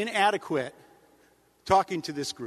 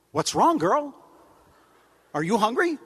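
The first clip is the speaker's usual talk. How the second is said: higher, louder mock coo to a dog; talking to the dog; 4 semitones higher, 5 dB louder than usual. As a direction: fuss over the dog like a dim owner. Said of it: "They go".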